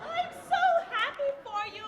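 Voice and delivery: high, theatrical squeal of joy; overjoyed gushing. Acted. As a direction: gush with delight at the engagement. Grandmother hears of the engagement.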